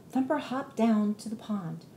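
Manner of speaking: usual speaking voice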